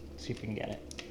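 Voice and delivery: soft whisper while tuning in. Real, not acted. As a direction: whisper it as you tune the dial. Tuning the radio.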